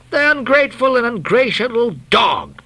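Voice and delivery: Starbuck's high, affronted exclamation; indignant cry. A narrator's reading. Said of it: "cried Starbuck".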